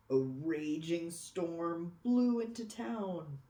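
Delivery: ordinary delivery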